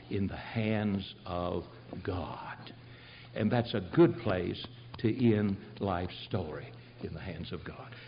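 soft, low, fading whisper; hushed close. Real, not acted. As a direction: let it fall to a hush. Sermon closes in a hush.

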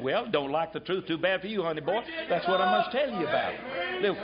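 ordinary talk, nothing marked

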